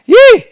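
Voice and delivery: loud, high joyful yell; excited whoop. Real, not acted. Pastor whoops with excitement over the Scripture. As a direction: whoop it out with joy.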